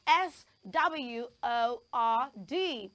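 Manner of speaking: slow, deliberate spelling. Shown as a demonstration. Teacher spelling a word to the class, slow and deliberate. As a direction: spell it letter by letter, slowly. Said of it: "the sixth word we're learning to pronounce".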